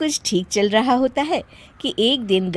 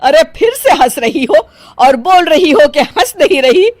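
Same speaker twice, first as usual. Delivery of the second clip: louder, sharp and fed up; exasperated scolding; 3 semitones higher, 6 dB louder than usual. Acted. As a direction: call out the lie angrily. Mother-in-law exasperated scolding.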